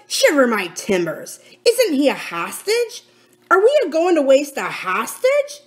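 emphatic, with rising indignant questions; exasperated pirate captain. Acted. Silver defends keeping the boy as hostage, exasperated. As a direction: berate the fools with rough sailor talk.

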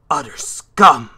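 contemptuous outburst. Acted. Facing a lowly enemy, slow contemptuous outburst. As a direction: spit it out in disgust.

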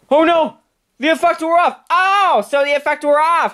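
high-pitched exclamations; acted high-pitched alarm; alarmed outburst. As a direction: cry out in dismay, voice jumping high. Alarm that the secret is out.

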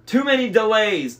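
loud, high monster bellow; booming outburst, pitch pushed up. A narrator's reading. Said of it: "the monster bellowed".

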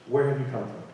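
soft, quiet "Where have you come from?"; gentle question. Acted. God asking Hagar, soft and quiet. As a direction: ask it tenderly, quiet.

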